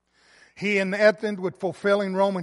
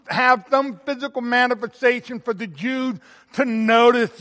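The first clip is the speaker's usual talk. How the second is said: louder and higher, stumbling with urgency; impassioned preaching; 4 semitones higher, 5 dB louder than usual. Acted. Pastor pictures Jesus at the grave for the church, impassioned. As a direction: push the grief of the scene out strongly.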